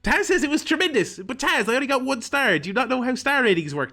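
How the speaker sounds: high, loud disbelieving protest; exasperated complaint. Real, not acted. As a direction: rant at the game's commentator. Frustrated at one-star rating.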